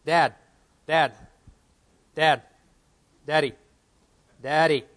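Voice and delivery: loud, repeated calls for Dad from another room; child calling out. Retold or imitated. Little son calling his dad from the bedroom, loud calling out. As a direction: call out for Dad like a little boy who won't stop.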